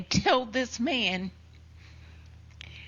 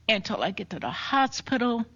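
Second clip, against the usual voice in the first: ordinary talk, nothing marked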